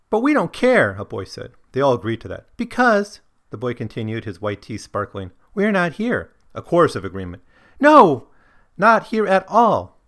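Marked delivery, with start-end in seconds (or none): 0.1-0.9 put-on high, loud boyish voice; boy in the story. Boy voiced in read quote. 2.6-3.2 put-on high, loud boyish voice; boy in the story. Boy voiced in read quote. 5.5-6.3 put-on high, loud boyish voice; boy in the story. Boy voiced in read quote. 7.8-10.0 high, loud, slow chorus; emphatic crowd voice, well above the narration; chorus of agreement. Acted. Chorus voiced in read quote.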